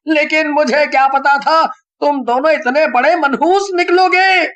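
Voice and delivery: high, loud exasperated rant; angry scolding. A narrator's reading. Rohan scolding the two friends for dragging him in. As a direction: tell them off, fed up.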